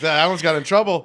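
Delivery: ordinary talk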